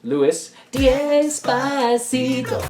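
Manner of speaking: slow, high, drawn-out "Louis D'Esposito"; drawn-out name. Pretend. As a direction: savour each syllable of the name. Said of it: "Wait, what's his name again?".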